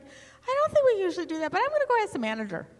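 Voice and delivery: put-on high, quick young shop clerk; young saleswoman voice. Young cashier answering a haggling customer, high and hesitant. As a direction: play a flustered teen cashier, voice way up. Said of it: "She's like".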